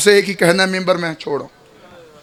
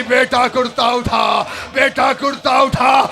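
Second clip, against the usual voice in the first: voice from the grave; 3 semitones higher than usual. Acted. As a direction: call out from the grave to lift the shirt. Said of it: "आवाज़ आई".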